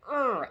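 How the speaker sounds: loud high exasperated groan; frustrated groan. Retold or imitated. Fed up with her weight and her day, loud groan.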